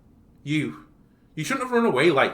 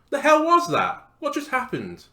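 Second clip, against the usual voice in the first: much higher, louder startled cry; shocked outburst; 13 semitones higher, 6 dB louder than usual. Acted. Student reacting to the vanished figure, loud and shocked. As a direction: blurt it out in shock.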